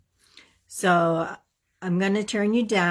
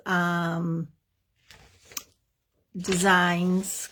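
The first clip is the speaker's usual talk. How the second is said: normal talking voice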